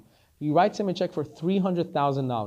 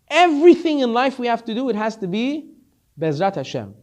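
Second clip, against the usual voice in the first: louder and higher, urgent; preaching; 7 semitones higher, 7 dB louder than usual. Acted. Preacher urging the listeners to trust Hashem, raised. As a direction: lift it like a sermon climax.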